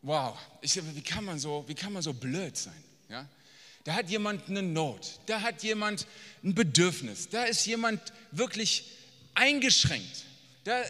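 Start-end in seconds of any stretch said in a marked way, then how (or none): none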